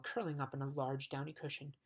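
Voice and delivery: ordinary delivery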